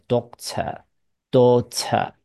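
slow "doctor, daughter", both ending without an R; British silent R. Shown as a demonstration. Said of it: "英国人就不卷舌了". (英式 doctor、daughter，都不卷舌)

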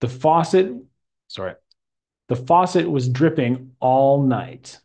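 a teacher's reading aloud for the class to echo; model reading. Shown as a demonstration. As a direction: read it clearly with a careful rise and fall in the voice. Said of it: "listen to the way I say it".

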